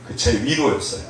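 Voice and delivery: ordinary talk, nothing marked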